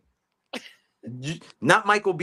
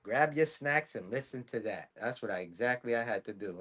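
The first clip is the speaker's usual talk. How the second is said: normal talking voice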